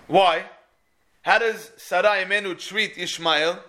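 ordinary talk

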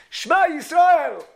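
Shema Yisrael cried out high, loud and slow; crying out. Acted. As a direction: draw out Shema Yisrael as a fervent cry. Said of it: "we try".

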